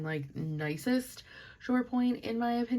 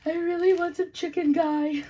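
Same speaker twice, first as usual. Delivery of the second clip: high, louder pleading whine; whiny craving; 12 semitones higher, 7 dB louder than usual. Retold or imitated. Sad girl begging her friend for fried chicken, high whiny plea. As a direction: beg for comfort food like a kid. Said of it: "And I was like".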